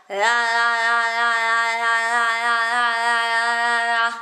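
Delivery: tongue-stretching la la la; sing-song la la drill; la la vocal warm-up. Shown as a demonstration. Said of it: "We go".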